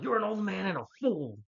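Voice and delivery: high, loud retort in a quarrel; mock angry yell. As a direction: imitate a son yelling back at his father. Thor yells back in acted quarrel.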